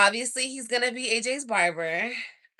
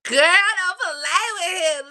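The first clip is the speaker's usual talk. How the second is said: swooning; 10 semitones higher, 8 dB louder, slower than usual. Real, not acted. Swooning over his photo.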